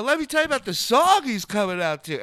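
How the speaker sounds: high, loud host patter; loud stage announcement. Retold or imitated. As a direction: imitate pitching a bit to a whole theater. Retold stage announcement to crowd.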